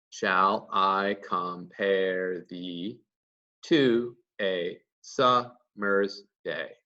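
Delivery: very slow, each syllable of "Shall I compare thee to a summer's day" set apart; counting syllables. Shown as a demonstration. Teacher counting ten syllables per line, very slow and separated. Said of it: "if you count five stressed and five unstressed syllables".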